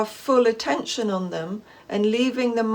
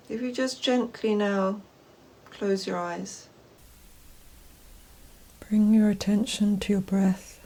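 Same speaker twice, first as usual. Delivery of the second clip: guided meditation; 2 semitones lower, 4 dB quieter than usual. Acted. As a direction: gently guide them inward, unhurried and even, leaving space after each phrase.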